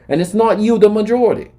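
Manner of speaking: ordinary talk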